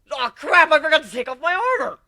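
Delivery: high, loud panicked yelp; alarmed realisation. Acted. Narrator realising he forgot his armor, panicked yelp. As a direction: blurt it out in sudden panic.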